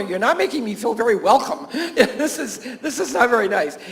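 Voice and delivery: amused mock complaint through laughter; laughing. Teacher keeps telling the counselor with the tic, laughing. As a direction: tell her off while cracking up. Teasing retold reply, laughing as she tells.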